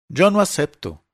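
put-on a girl in the doorway refusing her father, loud and defiant; defiant refusal. A narrator's reading. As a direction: plant your feet and refuse him. Daughter defies father at door.

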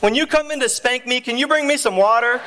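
high, called-out request; son yelling out. Acted. Son asking dad for water, yelling. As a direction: call from bed to another room. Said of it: "the son yells out".

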